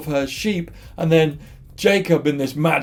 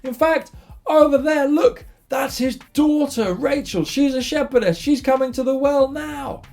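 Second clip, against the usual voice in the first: high-pitched, eager pointing-out; acted excitement, voice lifted high; excited shepherds; 9 semitones higher than usual. Shepherds show the traveller the daughter coming to the well, excited.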